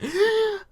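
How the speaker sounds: high sharp mock gasp. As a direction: gasp in fake shock. Mock shock at being underage.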